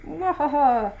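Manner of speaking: mock mwahaha laugh; a hammed-up villain cackle; evil laugh. Villain laugh after master plan.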